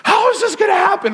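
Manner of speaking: high, fast panicked squeal; laughing mock panic; scared kid. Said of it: "I was like".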